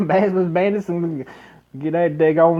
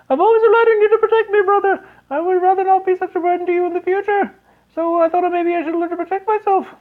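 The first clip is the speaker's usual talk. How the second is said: put-on very high, louder falsetto girl; sister's voice; 5 dB louder than usual. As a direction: squeak it as the little sister. Sister speaks to brother.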